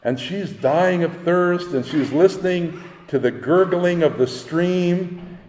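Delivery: ordinary talk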